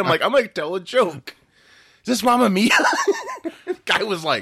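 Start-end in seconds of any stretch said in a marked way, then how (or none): 0.2-2.7 high, loud, giddy joking; laughing, over-eager high voice; retold excitement. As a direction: act out bursting to crack a joke. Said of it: "I was so excited".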